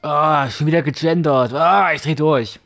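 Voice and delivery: loud, exasperated complaint. Real, not acted. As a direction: vent your frustration. Annoyed at gendered game text.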